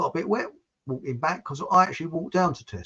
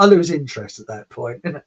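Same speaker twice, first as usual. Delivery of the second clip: ordinary talk, nothing marked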